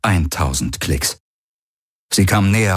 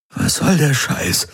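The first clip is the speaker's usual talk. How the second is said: higher, croaked disbelief; croaking; 8 semitones higher than usual. A narrator's reading. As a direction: croak it out, pitched up. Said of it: "krächzte Garlin". (krächzend hervorgestoßen)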